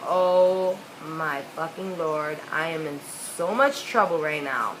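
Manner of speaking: slow, low "I'm doomed" realisation; retold inner dread, words dragged out; mock-grave slow horror at being caught; sinking dread.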